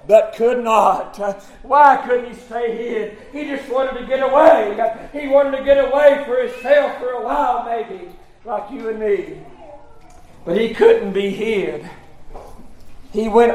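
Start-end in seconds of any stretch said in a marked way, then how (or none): none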